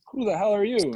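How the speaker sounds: high, loud angry comeback; angry retort. Retold or imitated. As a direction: fire it back at a stranger. Ejected player snaps at stranger.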